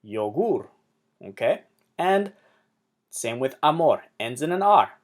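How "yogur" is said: "yogur" corrected to yo-GUR; "yogur" with a long stressed ending; stress on last syllable. Shown as a demonstration. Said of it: "emphasize the last syllable".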